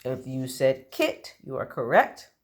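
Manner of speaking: usual speaking voice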